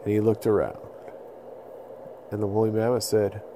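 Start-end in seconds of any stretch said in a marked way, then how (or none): none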